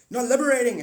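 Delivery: high, loud angry alien shout; alien argument. Pretend. Alien yelling at the rival alien, loud and high. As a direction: yell him down, furious and high.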